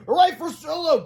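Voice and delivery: high cheering shout; excited cry. A narrator's reading. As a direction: cheer the hamster loudly. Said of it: "cried Harold".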